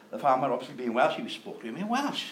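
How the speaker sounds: ordinary talk, nothing marked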